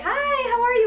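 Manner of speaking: high, bright cheerleader hello; a light mock cheerleader hi; mock girly greeting. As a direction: imitate the cheering girls greeting. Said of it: "they're like".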